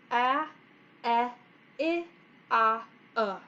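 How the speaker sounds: "A eh, I ah, uh" as five separate short vowels; short vowel sounds. Shown as a demonstration. Teacher recaps for kindergartners, isolated short sounds. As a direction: say "A" as in apple and "I" as in itch, pausing between. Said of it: "with the short sound".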